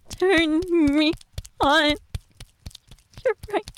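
ordinary delivery

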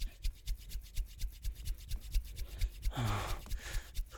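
long shaky exhale of frustration; deflated sigh; long frustrated sigh. Acted. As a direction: let out a long desperate sigh. Frustrated at being denied.

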